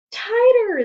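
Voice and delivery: very high, loud shout. Acted. The class shouting at Stephen to pull harder, loud shout. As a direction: bellow it at him. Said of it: "they all shouted again".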